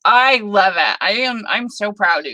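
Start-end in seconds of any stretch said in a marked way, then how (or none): none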